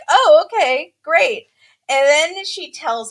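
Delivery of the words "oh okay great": higher, louder mock-agreeable reply; mock relieved acceptance. Family answers the suddenly recovered bride, mock agreeable. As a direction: imitate relatives going along with a sudden turnaround.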